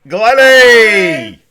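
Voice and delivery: Glennie! shouted high and loud in greeting; excited call out. Real, not acted. Host greeting his mate on the phone line, loud excited shout.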